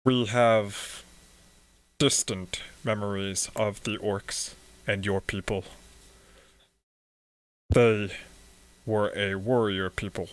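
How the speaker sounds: lightly voiced monotone priest. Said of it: "He speaks in this monotone voice".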